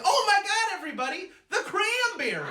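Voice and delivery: very high, loud mock panic; hammed-up high-pitched alarm; panicked mom shriek. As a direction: cry out like a mom who forgot a dish. Mom remembers forgotten dish.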